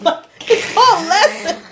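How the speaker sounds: laughing through the words, voice jumping up; breaking into laughter mid-sentence; laughing hard. Real, not acted. Friend laughing while recapping the dance lesson.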